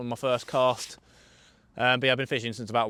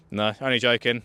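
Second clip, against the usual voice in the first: usual speaking voice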